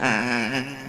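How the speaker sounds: stuttering on ah; mock stutter. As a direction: imitate a stutter. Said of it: "He be like".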